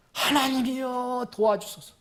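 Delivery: slow, drawn-out plea to God; prayerful cry for help. Acted. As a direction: stretch the address into a restrained cry. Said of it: "이렇게 얘기했을지도 몰라요". (하나님께 길게 부르짖는 간구)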